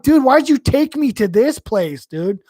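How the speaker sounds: whining at the buddy who brought him there; exasperated retold complaint. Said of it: "And I'm like".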